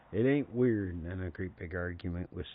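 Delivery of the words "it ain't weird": loud, higher argumentative comeback, retold; playful bickering outburst; mock indignant retort. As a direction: argue back like a stubborn relative. Retold bickering over the clock.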